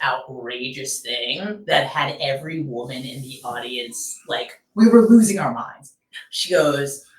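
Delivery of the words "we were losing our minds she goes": ordinary talk, nothing marked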